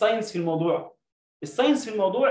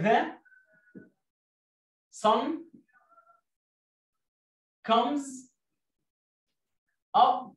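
word by word dictation; much slower than usual. Shown as a demonstration. Teacher dictating a sentence to pupils, word by word. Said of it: "هقول لك جملة أهي".

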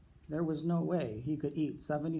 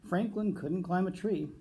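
ordinary delivery, nothing marked

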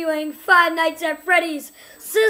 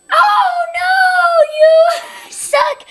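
high loud yell with a laugh; real angry yell; frustrated shout; 11 semitones higher, 7 dB louder, much slower than usual. Real, not acted. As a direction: yell at the game. Killed by animatronic.

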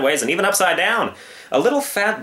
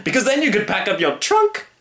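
high, eager sales pitch; excited circus showman; 10 semitones higher than usual. Acted. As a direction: sell it like a circus barker. Circus man joke.

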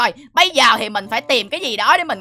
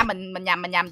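low, soft self-correction; sheepish aside; 7 semitones lower, 10 dB quieter than usual. Acted. Villain flubs his introduction, low sheepish aside. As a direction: drop right down, owning the slip. Breaks off after singing wrong intro.

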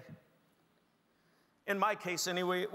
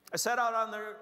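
usual speaking voice

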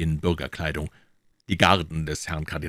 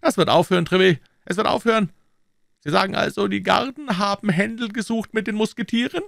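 very high-pitched, raised king's voice; indignant king; 5 dB louder, faster than usual. Acted. King questioning Treville about the guards' quarrel, very high royal voice. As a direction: play an agitated king in a high head voice.

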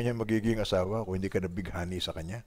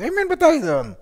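high, loud call-and-response shout; preacher booming for amen; 9 dB louder than usual. Real, not acted. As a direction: rouse the congregation.

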